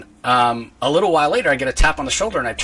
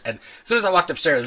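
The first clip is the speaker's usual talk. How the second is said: ordinary talk, nothing marked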